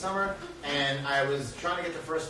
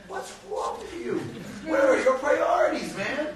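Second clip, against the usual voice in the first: high, loud, retold self-reproach; self-scolding; 8 semitones higher, 4 dB louder than usual. Father berating himself for leaving his son upset, exasperated. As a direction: give yourself a mock telling-off, pitched up.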